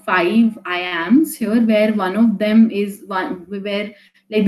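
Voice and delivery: ordinary delivery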